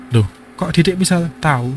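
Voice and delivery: put-on a mum puzzled at her child; mother surprised.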